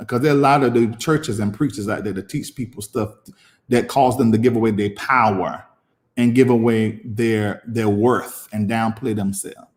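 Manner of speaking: ordinary delivery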